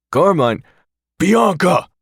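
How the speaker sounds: roar. Acted. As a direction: roar the names down the phone. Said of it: "I roar".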